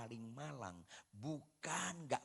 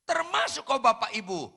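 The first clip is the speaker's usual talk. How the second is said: loud appeal; 10 semitones higher, 14 dB louder than usual. Real, not acted. Preacher addresses congregation.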